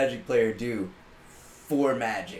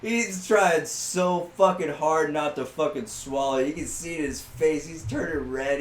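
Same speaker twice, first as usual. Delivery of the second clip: ordinary talk, nothing marked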